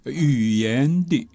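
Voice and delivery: slow, stretched syllables; drawn out. Shown as a demonstration. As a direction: stretch each syllable long. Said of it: "那我就说语言的".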